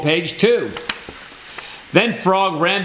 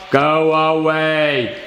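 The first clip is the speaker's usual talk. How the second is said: very slow, drawn-out groan of words; sleepy, reluctant Toad; 3 semitones lower, much slower than usual. A narrator's reading. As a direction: stretch every word like a grumpy sleeper. Sleepy Toad sends Frog away.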